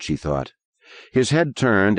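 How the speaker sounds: usual speaking voice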